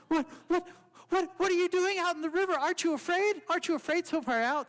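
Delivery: high, fast, panicky squeak; a small mouse startled and scared; frightened mouse. Acted. Mouse startled by its own reflection in the river, high panicky squeak.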